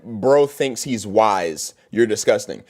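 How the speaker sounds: low, slow sneering read; mocking a hater comment. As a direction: drag out the words in a dull mocking tone.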